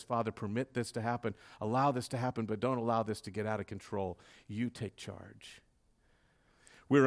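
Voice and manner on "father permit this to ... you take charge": hushed prayer. Retold or imitated. Quiet prayer to the Father.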